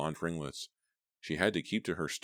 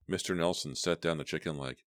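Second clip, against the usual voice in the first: plain reading voice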